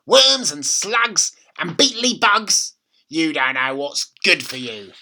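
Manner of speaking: high, slow, loud gloating chant; grandma taunting George; gleeful grandma. A narrator's reading. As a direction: savour each word.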